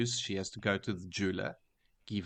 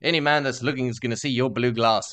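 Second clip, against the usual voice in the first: loud, high eager sales pitch; 7 semitones higher, 7 dB louder than usual. Retold or imitated.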